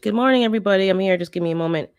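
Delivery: usual speaking voice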